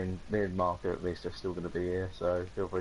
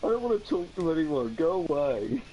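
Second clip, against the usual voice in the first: mock depressed; 6 semitones higher than usual. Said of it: "you're really depressed or something".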